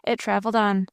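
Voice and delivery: usual speaking voice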